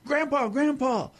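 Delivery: put-on high child's voice calling out; little boy calling. A boy in bed calls his grandpa back, high. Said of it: "gets about three steps".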